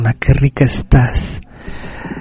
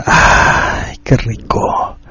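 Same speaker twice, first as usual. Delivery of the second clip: aroused sigh; 3 semitones lower, much slower than usual. Acted. Erotic message, aroused sigh.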